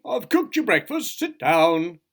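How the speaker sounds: high, chirpy host; cheerful. A narrator's reading. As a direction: invite with bustling good cheer. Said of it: "said his cousin cheerfully".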